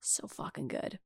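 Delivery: breathy whisper. Real, not acted. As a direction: whisper it as an aside. Aside about tasty soda.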